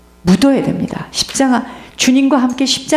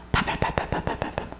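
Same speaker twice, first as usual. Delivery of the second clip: whispered smack sounds; 14 dB quieter than usual. Retold or imitated. Imitating beating sounds.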